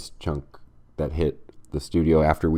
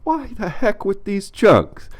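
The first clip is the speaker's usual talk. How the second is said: mock outrage; 14 semitones higher, 4 dB louder, slower than usual. Comic segment title outburst.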